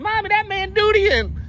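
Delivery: high, loud mock kid's cry; screaming child. Kids in the drive-thru to their parents, mock child's scream. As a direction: imitate a little kid yelling for mommy. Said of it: "pointing and screaming at their parents".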